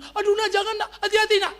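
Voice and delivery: high-pitched mock parental panic; hammed-up worried squeal; fretting parent. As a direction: act a parent anxiously warning a child up a tree. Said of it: "Jangan kau bilang".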